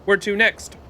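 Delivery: ordinary talk, nothing marked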